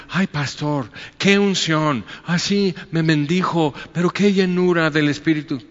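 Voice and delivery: high, gushing mock praise of the pastor; gushing churchgoer. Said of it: "a veces dices".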